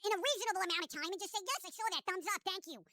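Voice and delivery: quiet, whiny obsessed friend; clingy stalker voice. Acted. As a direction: nag softly like a clingy stalker. Acts obsessive needy texter.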